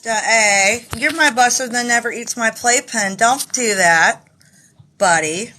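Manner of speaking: loud, high, teasing scold; playful pet scolding. Real, not acted. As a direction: tell the pet off fondly.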